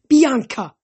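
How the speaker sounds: loud anguished call. A narrator's reading. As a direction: cry out to her. Nico seeing his dead sister.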